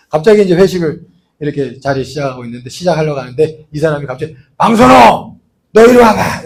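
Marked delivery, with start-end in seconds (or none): 4.6-6.4 loud, higher barking summons; boss yelling. Retold or imitated. Irritated boss calling the employee over at a dinner, loud yell.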